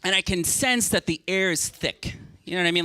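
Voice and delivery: ordinary talk, nothing marked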